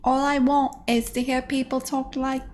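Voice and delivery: lightly put-on stage Cockney; a hint of school-play Cockney; mock Cockney. Streamer wishing people talked in fake Cockney. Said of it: "When they talk like this".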